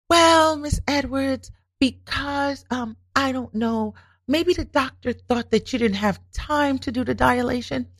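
lightly voiced hesitant receptionist, slow; a hint of a fumbling clerk; mimicked receptionist.

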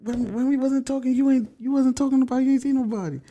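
high, fast accusing rant. Retold or imitated. Boyfriend confronting girlfriend, high accusing rant. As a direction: pile on the accusations. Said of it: "I'm like".

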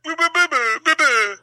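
mock a goofy high-pitched voice; stupid voice. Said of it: "that stupid voice you do".

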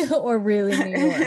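laughing through the words; giggling while speaking. Real, not acted. Laughing at accent joke.

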